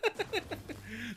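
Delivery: high, soft burst of giggles; real high-pitched laugh, kept quiet. Real, not acted. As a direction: laugh helplessly but softly. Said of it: "I'm just laughing".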